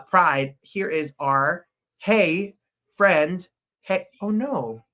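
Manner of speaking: ordinary delivery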